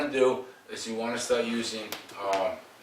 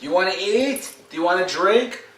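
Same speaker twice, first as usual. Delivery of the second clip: higher, rising coaxing questions; mock coaxing questions; 9 semitones higher, 4 dB louder than usual. Shown as a demonstration. Trainer mocking owners' coaxing questions to the dog. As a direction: coax the dog with silly questions. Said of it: "Stop all that".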